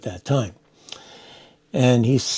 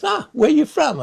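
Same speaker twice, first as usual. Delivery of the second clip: friendly higher quote; 4 dB louder than usual. Retold or imitated. Chuck Hill greeting the newcomer.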